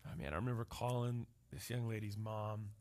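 ordinary talk, nothing marked

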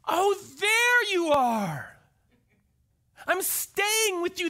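high, slow, loud and delighted; Jesus calling up to Zacchaeus with delight; joyful welcome. Retold or imitated. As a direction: call up into the tree with joy. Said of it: "sees Zacchaeus and goes".

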